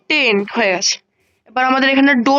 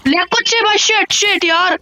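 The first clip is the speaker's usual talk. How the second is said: panicked; 7 semitones higher than usual. Real, not acted. Panicking as zombies attack.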